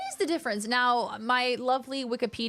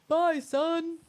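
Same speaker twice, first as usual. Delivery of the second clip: Bison high; 6 semitones higher than usual. Shown as a demonstration.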